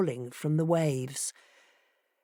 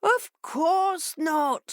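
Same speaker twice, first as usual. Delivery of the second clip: much higher, slow, unbothered fox; cool fox; 11 semitones higher, much slower than usual. A narrator's reading. Fox lying calmly to the fishes, slow and cool. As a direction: drawl it coolly.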